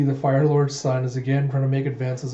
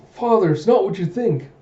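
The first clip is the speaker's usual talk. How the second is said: higher acted objection; flustered protest; 6 semitones higher, 4 dB louder than usual. Princess correcting her father's assumption, flustered. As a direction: protest hastily with raised pitch.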